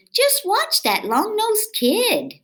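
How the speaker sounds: high, slow marveling voice; townsfolk admiring. Acted. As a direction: call it out like amazed neighbors. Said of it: "everyone in town would say".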